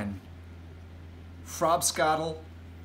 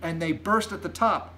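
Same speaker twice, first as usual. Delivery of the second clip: put-on higher, earnest girl's voice; light, raised-pitch explanation; Sophie explaining; 7 semitones higher than usual. A narrator's reading. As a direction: explain it pointedly as a child. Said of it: "Sophie said".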